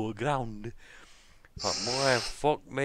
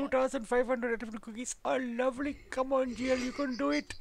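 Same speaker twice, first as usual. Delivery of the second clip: playing a caricature Indian caller; put-on Indian accent; 13 semitones higher than usual. Prank caller voicing an Indian man, hammed-up Indian accent. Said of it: "play voices against him".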